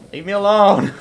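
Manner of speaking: high, loud plea to back off; frustrated shout. Real, not acted. Gamer harassed by enemies, frustrated shout. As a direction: shout it at pursuers.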